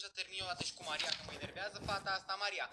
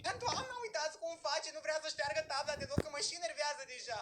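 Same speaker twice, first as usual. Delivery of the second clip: high-pitched complaint to the teacher; exasperated tattling; 6 semitones higher than usual. Acted. Pupil complains to teacher in quarrel.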